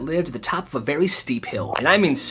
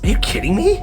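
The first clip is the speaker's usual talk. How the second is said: higher, incredulous; can't believe it; disbelief; 8 semitones higher, 3 dB louder than usual. Real, not acted. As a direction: narrator outraged at the school policy, incredulous. Outraged narrator aside.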